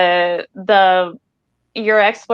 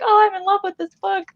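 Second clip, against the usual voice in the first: delighted gush; 13 semitones higher than usual. Retold or imitated. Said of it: "it's like".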